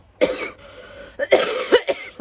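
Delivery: harsh real cough; hacking cough; coughing fit. Real, not acted. Sick with covid, coughing.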